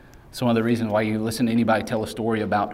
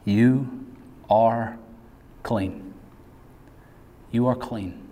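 preacher reassuring abuse survivors tenderly; tender assurance; much slower than usual. Acted. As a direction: speak it gently to the hurt listener. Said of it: "the voice of Jesus saying to".

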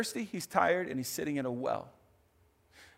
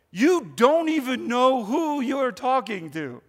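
slow, kind invitation; gentle, not arrogant; 7 semitones higher, 6 dB louder, slower than usual. Retold or imitated. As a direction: say it slowly and warmly, no edge. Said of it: "that's not the tone he's saying it".

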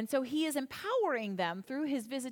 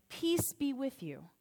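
slow, soft, gentle blessing; calm the frightened disciples slowly; gentle greeting; 5 dB quieter, slower than usual. Retold or imitated. Jesus greeting scared disciples, slow and soft. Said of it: "he has to say twice to them".

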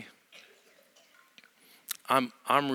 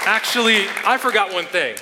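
raised voice, high and loud; calling over applause; 10 semitones higher, 10 dB louder than usual. Real, not acted. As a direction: call out over a clapping crowd.

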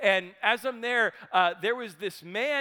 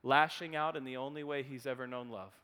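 soft, tender insight; 8 semitones lower, 10 dB quieter than usual. Retold or imitated. Therapist gently naming the man's childhood wound, soft. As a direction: speak to the hurt boy inside. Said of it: "And Wayne said".

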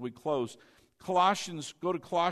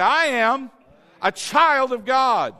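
louder, slower, every word planted firmly; said like you mean it; 7 semitones higher, 10 dB louder, slower than usual. Shown as a demonstration. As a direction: declare it as if you truly believe it. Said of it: "Now say it like you mean it this time".

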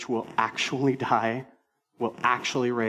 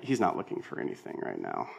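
much lower and softer, aside to self; inner thought; 7 dB quieter than usual. Retold or imitated. Pastor's private thought while his friend rifles through a bag, low and soft. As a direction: think it quietly to yourself. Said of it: "And I'm like".

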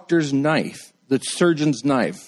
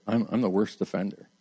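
quiet confession; 5 semitones lower, 8 dB quieter than usual. Retold or imitated. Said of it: "He said".

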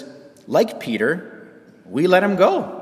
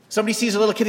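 ordinary talk

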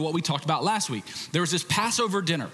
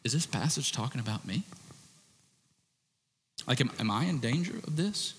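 worried self-question; 3 semitones lower, 5 dB quieter than usual. Retold or imitated. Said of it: "read through Hebrews six and go".

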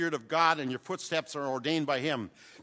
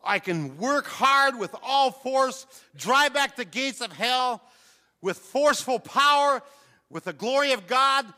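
loud, higher fiery preacher's cry; preaching at full force; 8 semitones higher, 7 dB louder than usual. Acted. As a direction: thunder it out to the church.

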